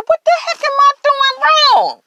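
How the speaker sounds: high, frustrated, disbelieving cry; exasperated outcry. Retold or imitated. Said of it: "I said".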